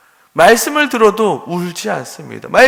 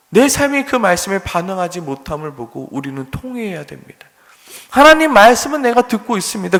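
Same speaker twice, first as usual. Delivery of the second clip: pleading prayer; 5 semitones higher, 4 dB louder than usual. Acted.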